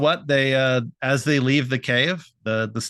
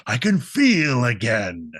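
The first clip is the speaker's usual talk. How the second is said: revived ghost. Acted. Revived ghost revels in having flesh and blood, hammed-up delight. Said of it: "'cause one even says".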